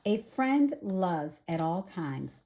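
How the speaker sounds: usual speaking voice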